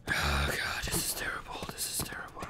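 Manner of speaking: hushed, scared whisper. Real, not acted. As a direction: breathe it out in dread. Creeped out in dark level.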